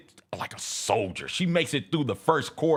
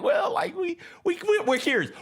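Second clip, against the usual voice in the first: sheepish excuse. Retold or imitated. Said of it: "It's like".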